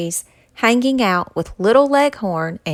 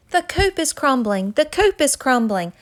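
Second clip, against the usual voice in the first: panicked cry; 6 semitones higher, 4 dB louder than usual. A narrator's reading. CJ repeats panic to Cocky.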